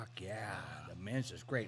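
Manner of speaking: whispered, low-key approval; soft, whispery retold reaction; hushed aside. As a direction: keep it under your breath. Hushed aside while retelling the tasting.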